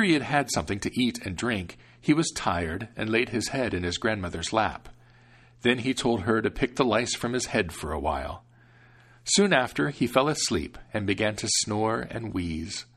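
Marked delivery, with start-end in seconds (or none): none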